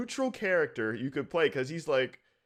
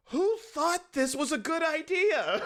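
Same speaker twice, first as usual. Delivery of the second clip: incredulous; 13 semitones higher than usual. Retold or imitated. Mock outraged question about game.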